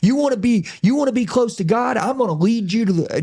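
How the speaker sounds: high-pitched, hard-driving preacher impression; yelling preacher. Preacher challenging his congregation with hard truth, fired-up preacher voice. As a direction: imitate a blunt preacher working the room. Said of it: "preacher talk just like this".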